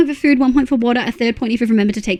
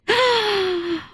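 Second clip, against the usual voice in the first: high, soft gasp of awe; amazed gasp; 9 semitones higher, 4 dB quieter than usual. Real, not acted. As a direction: gasp at a lovely sight. Reacting to friends' photos.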